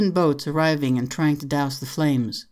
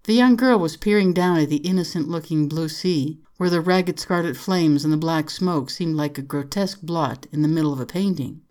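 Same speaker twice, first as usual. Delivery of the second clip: usual speaking voice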